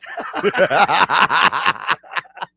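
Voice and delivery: long rolling burst of laughter; hearty laugh. Real, not acted. Host amused that listeners can rip his co-host, laughing hard.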